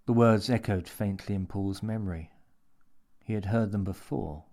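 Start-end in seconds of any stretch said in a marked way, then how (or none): none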